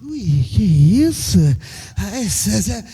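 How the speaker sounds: low, slow mock-flustered squeal; coy reaction. Pastor mimics a wife's coy reply to the flirt, slow and mock-flustered. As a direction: play the flattered wife, lightly. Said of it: "Aí você".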